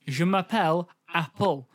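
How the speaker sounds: deliberate, flat classroom-video delivery; flat lesson-video voice. Acted. Co-host mimicking a French class video, flat. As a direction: imitate a stilted language tape. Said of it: "it's very flat".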